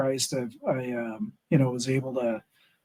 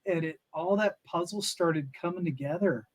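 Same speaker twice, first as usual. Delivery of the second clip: usual speaking voice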